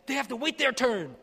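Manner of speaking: high, loud, dismissive bark; shouting. Retold or imitated. Sheikh shouting back at the aid worker, loud high bark. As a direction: snap it back as a loud order. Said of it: "And shouting".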